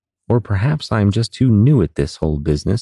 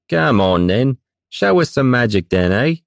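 jeering sailor; 5 semitones higher than usual. Acted. Drunken sailors egging him on.

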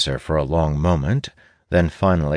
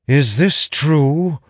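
slow, high, stunned disbelief; shocked question; 11 semitones higher, 5 dB louder, much slower than usual. Acted. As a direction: gasp it out in shock. Magus shocked by accusation.

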